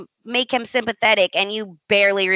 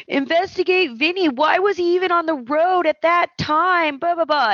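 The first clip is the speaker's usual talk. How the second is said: high put-on pleading voice; mock pleading Liam; 9 semitones higher than usual. Liam urging Baker to investigate Vinny, high mock plea. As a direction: beg the cop, pitched way up. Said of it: "Liam's telling Baker".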